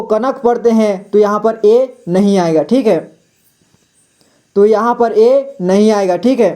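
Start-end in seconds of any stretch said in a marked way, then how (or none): none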